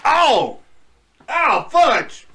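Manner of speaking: high, loud pretend yelp of pain; mock pained yell. Friend acts out stubbing his toe, loud mock howl. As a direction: howl like a stubbed toe, then curse politely.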